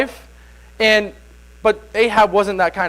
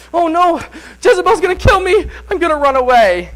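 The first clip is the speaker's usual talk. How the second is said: high, loud frightened cry; panicked Elijah; 8 semitones higher, 3 dB louder than usual. Retold or imitated. Elijah panicking that Jezebel will kill him, high frightened voice. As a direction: squeal it like a man fleeing for his life. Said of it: "But he goes".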